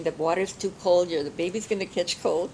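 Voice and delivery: mild bossy. Retold or imitated. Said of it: "My mother's bossing all of us".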